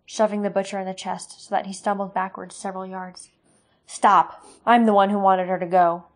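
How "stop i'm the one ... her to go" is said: loud cry to stop them; shouted plea. Acted. Katrina shouting at her father to stop the fight, loud.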